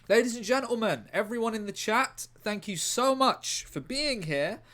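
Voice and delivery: loud, high showman's address to the crowd; announcer. Acted. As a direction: project it like a master of ceremonies.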